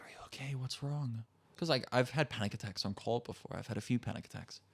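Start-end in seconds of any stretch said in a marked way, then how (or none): 0.0-1.2 whispered worried question; soft, unvoiced, asking if he is okay. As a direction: hush it like a worried girlfriend. Girlfriend asking softly on call.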